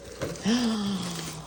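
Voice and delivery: long, soft intake of breath; delighted gasp. Real, not acted. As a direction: gasp at a lovely find. Finds another loaf.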